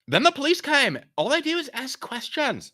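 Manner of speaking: very high, loud surfer whine; rattled young man grumbling about the police; California dude. Acted. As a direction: complain like a frazzled California dude.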